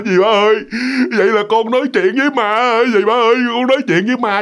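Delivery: high, wailing cry for auntie; crying in fright. Acted. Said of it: "bạn khóc lóc um sùm hết".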